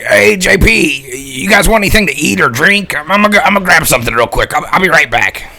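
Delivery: loud, high call across the street; calling out loudly to a friend across the lot; yelling out. Acted. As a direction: shout it over to him. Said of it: "he yells out to J P".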